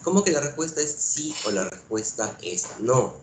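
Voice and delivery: ordinary talk, nothing marked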